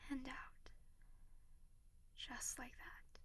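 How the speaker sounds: slow whispered breathing guide; calm them down, slow and hushed; soothing whisper. Acted. Guiding deep breaths.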